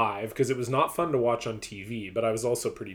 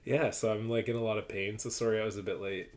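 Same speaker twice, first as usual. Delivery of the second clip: usual speaking voice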